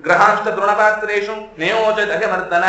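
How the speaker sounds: slow, measured verse recital; shloka recitation. Acted. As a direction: recite each syllable deliberately. Sanskrit verse recited slowly. (ನಿಧಾನವಾದ ಶ್ಲೋಕ ಪಠಣ)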